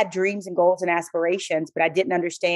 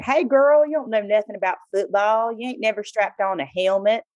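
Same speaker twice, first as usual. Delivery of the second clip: bad Southern accent; 3 semitones higher than usual. Male caller doubting her football knowledge, bad Southern accent. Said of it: "I'm gonna do a bad Southern accent".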